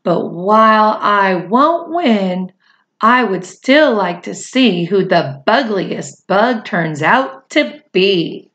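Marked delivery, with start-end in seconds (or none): none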